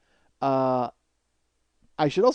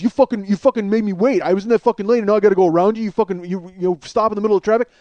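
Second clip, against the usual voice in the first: loud, raised, stumbling tirade; angry rant; 5 semitones higher, 5 dB louder, faster than usual. Retold or imitated. Passenger rants at the man who made him wait, angry. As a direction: sputter out the grievance heatedly.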